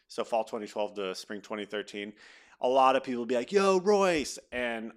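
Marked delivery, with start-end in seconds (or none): 3.5-4.4 higher, louder shout of a name across a crowd; mock fan call-out. Retold fans hailing him at games, calling out. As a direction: hail him like a fan spotting him.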